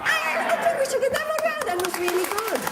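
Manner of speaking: high laughing praise. Real, not acted. Host laughing, praising the crowd.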